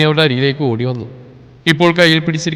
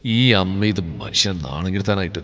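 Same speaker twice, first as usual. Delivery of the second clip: much lower, softer annoyed grumble; through gritted teeth; 8 semitones lower, 7 dB quieter than usual. Acted. Son annoyed by his mother's yelling, under his breath. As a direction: grit your teeth and grumble. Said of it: "പല്ലുകടിച്ചുകൊണ്ട്".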